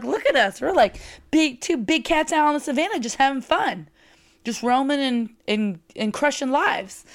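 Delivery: high, bubbling retold excitement; excited. To Mariah mid-event, giddy and high. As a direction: pitch it up like you're giddy at your rival. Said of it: "I'm like".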